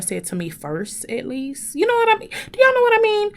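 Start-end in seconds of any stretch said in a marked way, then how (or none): 1.7-3.3 high pleading ask. Real, not acted. Asking viewers with high voice.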